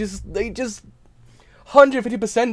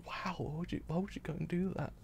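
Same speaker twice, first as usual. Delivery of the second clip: stunned near-whisper; 18 dB quieter than usual. Retold or imitated. Retold stunned reaction.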